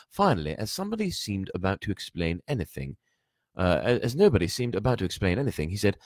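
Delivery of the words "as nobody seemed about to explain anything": ordinary delivery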